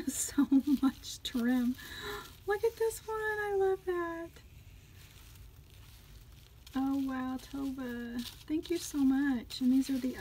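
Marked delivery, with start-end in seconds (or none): 2.5-4.3 thrilled high-pitched gushing; delighted. Real, not acted. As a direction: gush over it in a high voice. Delighted reaction to a gift.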